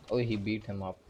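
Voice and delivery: ordinary delivery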